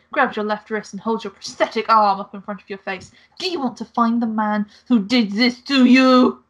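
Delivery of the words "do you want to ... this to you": hammed-up French accent, louder. Angel grabs the player's arm and tempts him, in mock French. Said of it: "Stop doing funny voices".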